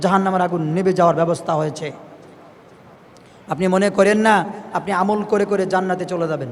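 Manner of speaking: ordinary talk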